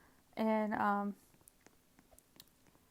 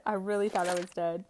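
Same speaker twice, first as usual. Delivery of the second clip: usual speaking voice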